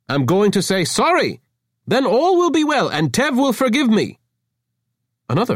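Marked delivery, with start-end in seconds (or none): 0.1-4.2 much higher, louder pompous proclamation; grand announcement. Acted. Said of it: "Avra announced grandly".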